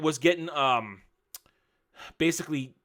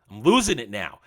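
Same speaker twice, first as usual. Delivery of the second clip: ordinary talk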